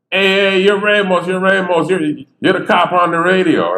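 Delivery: high, eager shout from the back; calling out to a famous cop; excited call-out. Retold or imitated. Handcuffed prisoner in the van calling to the radio cop, high and eager.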